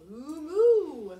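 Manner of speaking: loud, slow cow moo; expressive moo. Shown as a demonstration. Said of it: "hear some expression".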